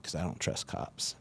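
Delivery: unvoiced, low-key admission; sheepish whisper. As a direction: murmur it like a guilty joke. Muttered confession, half-laughing.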